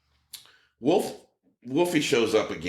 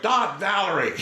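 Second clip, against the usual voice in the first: laughing, higher, louder call of "Dot Valerie"; mock yell; 8 semitones higher, 3 dB louder than usual. Son goofing on his mother's show tag, laughing mock yell. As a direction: shout "Dot Valerie" out like a prank. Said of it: "he was just like".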